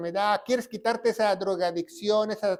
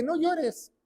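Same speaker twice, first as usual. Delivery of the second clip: mock comfort; 8 semitones higher than usual. Mocking telling widow not to cry.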